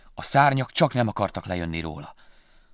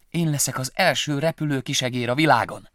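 higher, louder proud little mouse; proud dream; 5 semitones higher, 6 dB louder than usual. Acted. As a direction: announce it to the whole world. Willy dreams aloud proudly.

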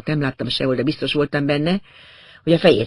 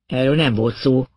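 usual speaking voice

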